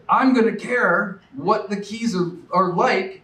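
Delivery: rally the room with it; rousing promise. Real, not acted. Candidate promising voters to care about the Keys, fired up.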